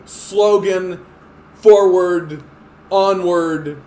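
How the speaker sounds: lightly hammed campaign slogan; mock slogan. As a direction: announce it like a campaign tagline. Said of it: "come out with".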